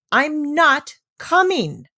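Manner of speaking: put-on a child's defiant refusal; stubborn repeat. A narrator's reading. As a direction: say it again, slow and firm. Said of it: "repeated Heidi".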